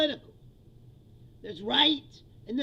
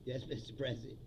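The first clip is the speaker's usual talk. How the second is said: much softer and lower, timid yes-man; softly imitating a reporter agreeing with the president; meek reporter; 10 semitones lower, 9 dB quieter than usual. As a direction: murmur it like a spineless reporter. Meek reporter just going.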